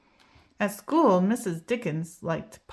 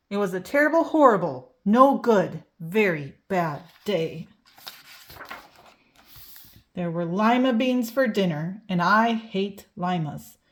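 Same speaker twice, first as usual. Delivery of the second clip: usual speaking voice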